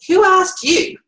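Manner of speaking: sweetly polite with a sting; mock polite. Shown as a demonstration. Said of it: "you could politely say".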